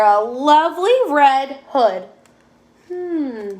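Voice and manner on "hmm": higher, softer musing hum; pondering. A narrator's reading. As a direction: wonder to yourself with a long hum. Dog puzzles over the book.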